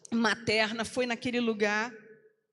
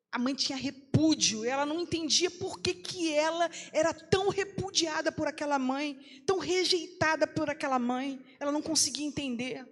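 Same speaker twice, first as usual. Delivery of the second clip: higher and louder emotional preaching; impassioned preaching; 5 semitones higher, 4 dB louder than usual. Acted. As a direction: push the rejection hard. Pregação emocionada em ritmo crescente. (pregação emocionada, mais alta e aguda)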